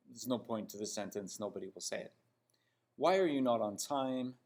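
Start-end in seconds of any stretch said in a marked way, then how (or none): none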